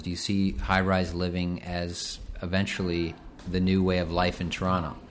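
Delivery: ordinary talk, nothing marked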